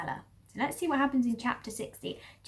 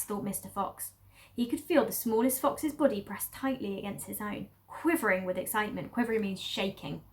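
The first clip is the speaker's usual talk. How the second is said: ordinary delivery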